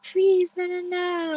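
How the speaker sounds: imitating his whiny pleading; mock begging ex. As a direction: play him as pathetic and desperate. Mocking ex begging her.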